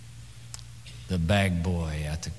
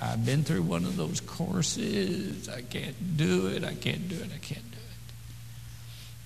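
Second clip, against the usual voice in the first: slower, higher, fading to a whisper; imitating a reluctant believer; 8 semitones higher, slower than usual. Preacher voicing a listener's excuses, slow and fading. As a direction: whine it, then let it die away. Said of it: "You start saying".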